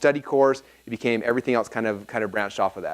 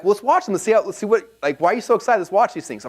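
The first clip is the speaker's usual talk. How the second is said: eager friends; 7 semitones higher than usual. Retold or imitated. Friends' eager reply, acted out.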